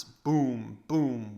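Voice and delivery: loud hollow boom; the stick's loud wooden boom; booming knock. A narrator's reading.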